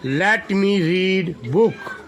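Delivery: very slow, every word drawn out; slow model reading. Shown as a demonstration. Teacher models English sentence for first-class children. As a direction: say it slowly so children can copy. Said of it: "teacher models for children".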